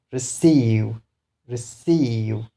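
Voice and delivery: re-CEIVE, long stressed second syllable in receive. Shown as a demonstration. Teacher tells learners where to stress, weight on CEIVE in receive. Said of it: "stress on this one".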